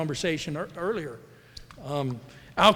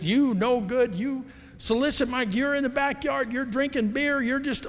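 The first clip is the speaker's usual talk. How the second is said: much higher, mock ranting tirade; imitating screaming agent; 9 semitones higher than usual. Retelling a furious realtor's phone rant, mock shrill fury. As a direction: play her raving at him, half-mocking. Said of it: "she was screaming at me".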